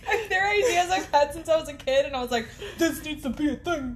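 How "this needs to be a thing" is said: put-on light little kid voice; a hint of an eager child; childhood voice. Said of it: "that's my childhood voice".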